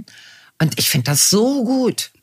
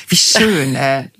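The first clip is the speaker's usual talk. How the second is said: usual speaking voice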